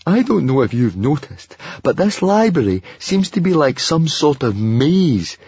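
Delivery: grumble, building to a fretful wail; grumbling. A narrator's reading. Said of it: "Fishlegs grumbled".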